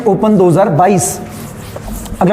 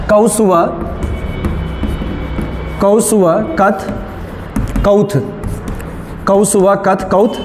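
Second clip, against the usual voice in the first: dictating; 4 dB quieter, slower than usual. Shown as a demonstration. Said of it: "लिखिए कौसुवा काथ कौथ".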